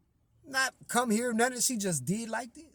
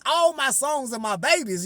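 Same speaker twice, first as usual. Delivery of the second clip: ordinary talk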